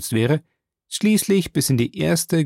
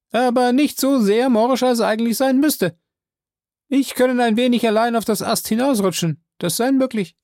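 high, loud servant voice calling down; Jupiter's voice; 11 semitones higher, 4 dB louder than usual. Acted. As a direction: pitch him well up and call out. Jupiter answers.

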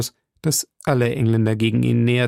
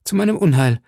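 higher, louder lament on meinem; wringing hands; 5 semitones higher, 3 dB louder than usual. A narrator's reading. Milady laments to Felton, rising despair. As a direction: begin the lament. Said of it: "rang Milady die Hände".

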